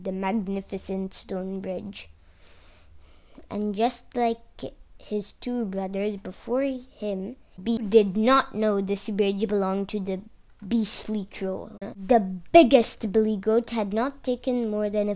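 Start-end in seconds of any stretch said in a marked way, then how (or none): none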